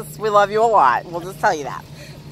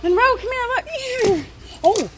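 high coaxing call; 14 semitones higher than usual. Real, not acted. Owner coaxing her dog over to see the cat, high sing-song. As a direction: sweet-talk the dog over.

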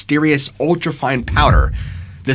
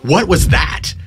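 horrified question, higher pitch, mildly acted; lightly dramatized horror, pitched up; 5 semitones higher, 4 dB louder, slower than usual. A narrator's reading. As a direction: narrator voicing a startled, horrified reaction. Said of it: "looks at Anders horrified and says".

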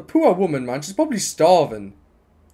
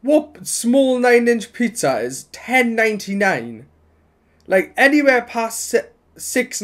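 incredulous; 7 semitones higher, slower than usual. Real, not acted. Outraged at the pizza price.

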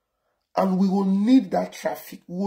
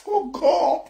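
high, helpless real laughter through the words; squealed out through laughter; laughing; 10 semitones higher than usual. Real, not acted. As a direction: crack up while exclaiming. Breaks into laughter mid-rant.

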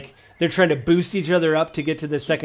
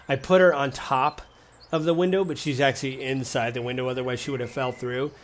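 normal talking voice

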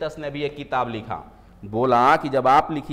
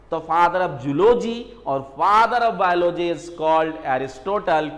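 slow dictation; 4 dB louder, much slower than usual. Shown as a demonstration. Teacher dictating exam answer.

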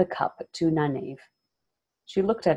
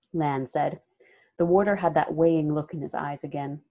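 ordinary delivery, nothing marked

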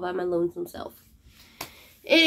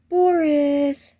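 calling the cat; 6 semitones higher, 6 dB louder than usual. Real, not acted. Calling her sick cat.

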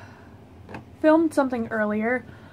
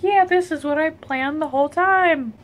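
mock casual; 6 semitones higher than usual. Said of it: "and be like".

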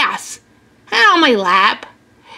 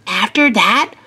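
ordinary delivery, nothing marked

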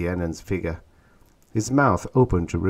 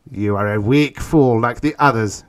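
loud, raised, gritted insult; gritted contempt; 4 semitones higher, 8 dB louder than usual. A narrator's reading. Tanub insulting Orn, gritted contempt. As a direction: force it out through gritted teeth. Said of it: "gritted Tanub".

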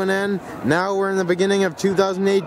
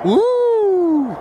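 high, loud celebratory woo; excited whoop; 12 semitones higher, 4 dB louder than usual. Real, not acted. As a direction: whoop with joy. New Year celebration cheer.

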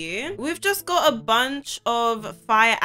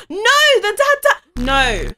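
very high, loud shriek of dismay; horrified high-pitched "no"; scared cry; 7 semitones higher, 7 dB louder, slower than usual. Real, not acted. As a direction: cry out in sudden fright. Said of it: "that scared me".

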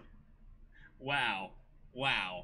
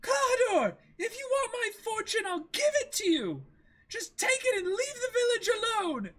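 put-on very high, pleading young woman; Kaya, desperate plea; 5 dB louder than usual. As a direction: offer everything to save the village. S1 voices Kaya.

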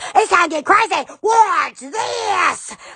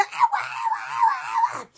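pained yelps; 5 dB quieter, slower than usual. Real, not acted. Yelping in pain.

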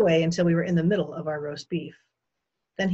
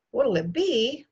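higher, amused repeat of the question; amused echo; 7 semitones higher, 4 dB louder, slower than usual. A narrator's reading. Guest repeats the host's drink question, amused. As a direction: echo it with a touch of mock laughter.

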